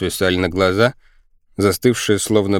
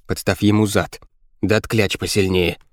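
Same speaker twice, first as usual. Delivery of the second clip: usual speaking voice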